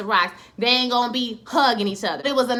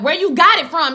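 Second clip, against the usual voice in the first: louder, higher, pressing question; insistent retold demand; 6 semitones higher, 8 dB louder than usual. Hippolyta pressing about the atlas, insistent. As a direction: hit it harder, demanding an answer.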